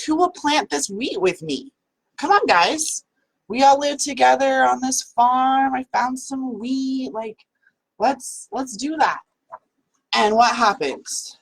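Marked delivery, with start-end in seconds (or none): 0.0-9.2 pleading hen. Acted. Hen pleads with farm friends.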